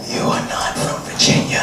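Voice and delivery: mock whisper. Host teasing audience member.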